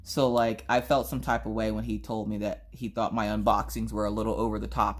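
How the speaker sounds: normal talking voice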